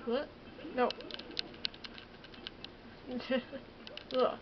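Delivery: ordinary talk, nothing marked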